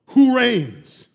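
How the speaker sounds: firm, loud question from God; God demanding an answer; commanding question. Acted. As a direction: declare it with authority. God asks, then repeats louder.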